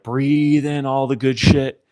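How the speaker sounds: slow, drawn-out breathing coach chant; hype lady. Retold or imitated. Imitating the lady hyping up racers before launch, drawn-out coaching. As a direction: stretch out each "breathe" like a coach. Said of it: "the lady is hyping us up".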